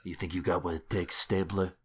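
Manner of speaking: a muttered New Jersey wiseguy threat; Tony Soprano impression. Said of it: "that Tony Soprano impression".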